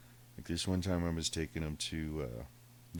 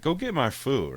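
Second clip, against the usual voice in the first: higher, louder commanding cat; bossy cat voice; 9 semitones higher, 7 dB louder than usual. Retold or imitated. Cat ordering his owner to fetch food, high and bossy.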